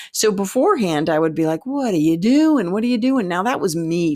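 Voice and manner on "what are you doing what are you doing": anxious self-talk, pitched up and louder; fear voice. Retold or imitated. Nervous performer to herself, fearful inner voice. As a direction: panicky inner voice asking herself. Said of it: "fear voice".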